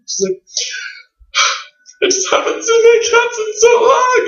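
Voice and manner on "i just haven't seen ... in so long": crying voice. Retold or imitated. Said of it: "And she starts to cry".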